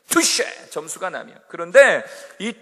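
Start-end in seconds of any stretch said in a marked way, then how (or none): 0.1-0.7 short high "투쉐" called out; touché call. Retold or imitated. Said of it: "소리를 지른다 그래요".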